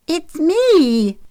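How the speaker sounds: put-on very high, loud child's voice; gleeful kid's squeak; giggly little girl. A narrator's reading. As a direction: pipe up high with giddy delight. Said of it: "Madison giggled".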